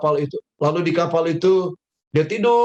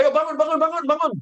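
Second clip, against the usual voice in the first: higher, pushy rousing; rough wake-up call; 7 semitones higher, faster than usual. A narrator's reading. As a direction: shake him awake with your voice. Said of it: "dibangunkan dengan kasar".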